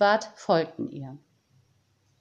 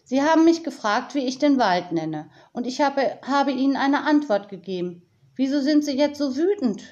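put-on higher, pleading girl's voice; a touch of despair in a raised voice; slightly desperate; 6 semitones higher, 3 dB louder than usual. A narrator's reading. Tiziana questions the angry woman, a bit desperate. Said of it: "in ihrer Stimme klang ein bisschen Verzweiflung mit".

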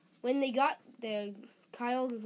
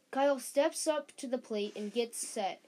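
ordinary delivery, nothing marked

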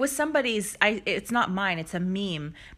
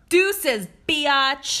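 mock sassy exit; 7 semitones higher, 7 dB louder than usual. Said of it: "Just enough time for you to say".

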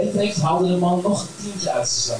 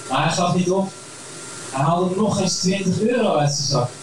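ordinary delivery, nothing marked

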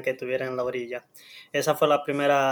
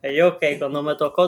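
ordinary delivery

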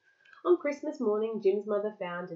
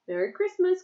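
high, louder mouse's greeting call; 11 semitones higher, 6 dB louder than usual. A narrator's reading. Mouse greeting the captain, calling out. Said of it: "called the mouse".

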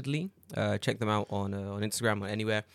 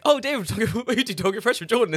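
higher, louder, laughing mock fan; laughing imitation; 11 semitones higher, 6 dB louder, faster than usual. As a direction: gush like an excited stranger. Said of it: "A lot of people were like".

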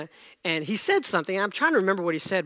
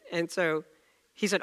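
ordinary delivery

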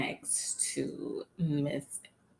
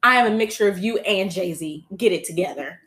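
sassy [name]; 3 semitones higher, 10 dB louder than usual. Retold or imitated. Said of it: "She was like".

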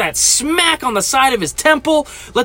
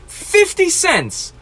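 incredulous; 9 semitones higher, much slower than usual. Real, not acted. Incredulous at complaint.

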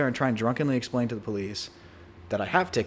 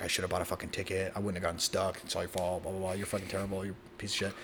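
lower, softer mock rant; mock angry girlfriend; 5 semitones lower, 9 dB quieter than usual. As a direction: grumble her complaints in a low mocking voice. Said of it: "she's fucking pissed".

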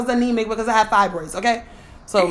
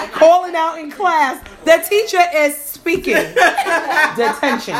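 laughing; 6 semitones higher, 4 dB louder, slower than usual. Retold or imitated. Laughing retelling class callout.

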